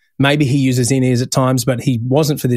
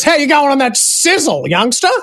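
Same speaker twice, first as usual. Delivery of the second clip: [name]; 13 semitones higher than usual. Retold or imitated. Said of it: "Angry goes".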